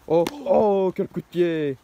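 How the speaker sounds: ordinary talk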